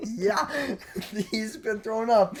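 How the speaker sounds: high, laughing mom impression. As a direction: giggle through it as mom. Voicing mom through laughter.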